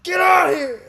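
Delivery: high, loud angry yell; shout. Retold or imitated. Friend bursting in, yelling at grandpa. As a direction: yell it at full volume. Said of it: "I'm like".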